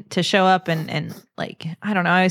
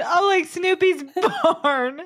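teasing imitation; 11 semitones higher, slower than usual. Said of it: "and you said".

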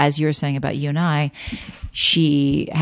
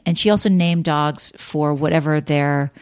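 ordinary talk, nothing marked

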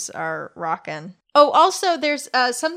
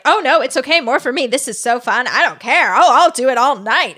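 loud, higher, hammed-up glee; fake delighted; 4 semitones higher, 9 dB louder than usual. To the girls, loudly faking that cleaning is fun. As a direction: oversell the chore as a treat.

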